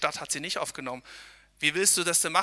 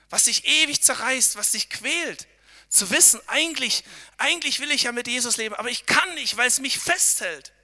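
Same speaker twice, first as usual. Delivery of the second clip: impassioned; 7 semitones higher, 5 dB louder than usual. Acted. Eindringliche Predigt.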